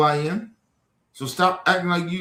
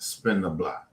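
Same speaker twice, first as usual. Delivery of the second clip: low, softer mocking of the troll's slang; 7 semitones lower, 5 dB quieter, slower than usual. Streamer mocking a chat troll's slang, low and soft. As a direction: sneer it low like you're parroting a troll. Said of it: "I don't even speak like that".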